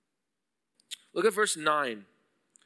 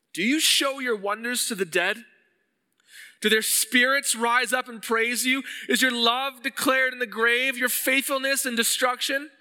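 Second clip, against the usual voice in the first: higher, lifted reading of the psalm's questions; scripture reading; 5 semitones higher than usual. Acted. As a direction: read it like an anguished plea. Reads psalm verses aloud.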